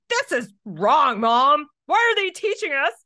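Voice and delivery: high, loud mock outrage; indignant daughter. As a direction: imitate an indignant kid protesting to mom. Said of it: "she gets all indignant".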